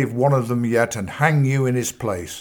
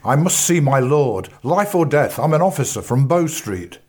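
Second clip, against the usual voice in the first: breathless, urgent lawman; urgent plea; 3 semitones higher, 3 dB louder than usual. A narrator's reading. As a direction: push past them, urgently. Bradbury pleading urgently at the door.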